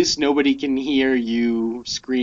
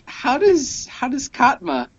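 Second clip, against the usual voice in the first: incredulous; 12 semitones higher than usual. Real, not acted. Incredulous question about Scottish aliens.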